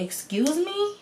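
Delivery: high, rising indignant question; indignant disbelief. Retold or imitated. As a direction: pitch it way up, taken aback. Offended reply to date's brush-off.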